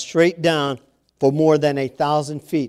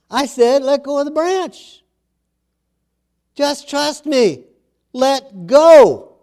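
calm insistent God; 10 semitones higher, 6 dB louder, slower than usual. Acted. God repeating his command.

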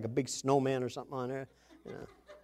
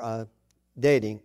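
usual speaking voice

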